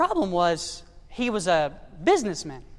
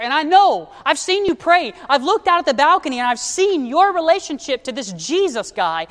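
louder and higher, pleading to be saved; pleading urgently; 5 semitones higher, 5 dB louder than usual. Acted. Dying boss begs his rancher to show him God, loud and urgent. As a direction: push it out high and loud, desperate to know God.

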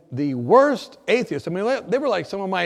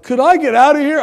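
loud, high, desperate plea to get out; panicked plea; 10 semitones higher, 14 dB louder than usual. Retold or imitated. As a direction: beg to escape, near panic. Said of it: "very frightened".

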